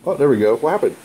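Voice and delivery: ordinary talk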